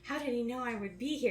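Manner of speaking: quick, mildly startled quote; hurried, lightly acted surprise; mock surprise. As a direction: imitate a villain caught off guard. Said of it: "Zoisite is just so surprised".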